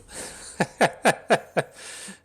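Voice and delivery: loud, high burst of laughter; real laugh. Real, not acted. Streamer laughing at his own defeat, incredulous laughter.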